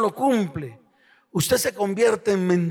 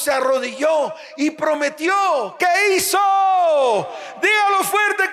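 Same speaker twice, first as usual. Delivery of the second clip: fervent preaching; 5 semitones higher than usual. Acted. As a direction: preach it to the crowd with full force. (predicación fervorosa, voz alzada)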